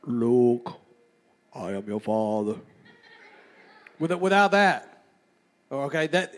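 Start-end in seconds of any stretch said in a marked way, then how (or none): 0.0-2.6 very low, slow movie-villain impression; Darth Vader. Imitating a film villain speaking to his son, deep and ominous. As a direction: drop deep and let each word weigh. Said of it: "that's Darth Vader".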